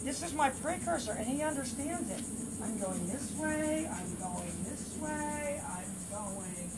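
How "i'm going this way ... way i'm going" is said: very slow, drawn out, fading softer; slow teasing cue. Real, not acted. Trainer luring the dog, slow drawn-out tease. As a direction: tease the dog along slowly.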